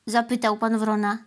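ordinary delivery

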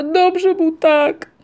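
high, loud, drawn-out wail; slow acted sob, pushed high; sobbing. A narrator's reading. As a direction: wail it out like an upset little creature. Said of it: "szlochała panna Migotka".

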